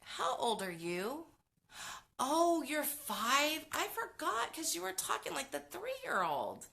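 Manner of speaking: put-on high, slow sing-song voice for a child; mock-sweet voice to a five-year-old; talking to a child. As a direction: coo at the kid in an exaggerated high voice. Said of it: "I would ask".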